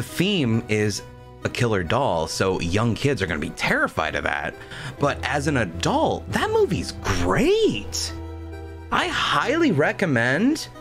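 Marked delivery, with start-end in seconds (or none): 6.3-10.7 high, slow, gushing praise; excited. Real, not acted. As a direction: rave about it. Gushing movie recommendation.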